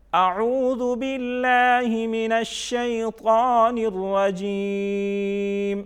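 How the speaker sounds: one slow connected chant, الرجیم held long and level; recited joined together. Shown as a demonstration. Teacher reading it whole for learners, joined-up slow recitation. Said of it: "اب ہم اس کو اکٹھا پڑھتے ہیں".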